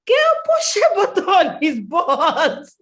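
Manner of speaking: high, loud, laughing instruction. Retold or imitated. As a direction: laugh it out as the bossy sister. Sister laughing, telling her.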